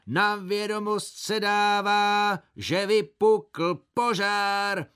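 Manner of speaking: high, slow, loud proclamation; town crier. Pretend. Village crier announces the fire in a slow, loud call. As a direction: drum out the news like a village crier. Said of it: "bubnoval a pravil".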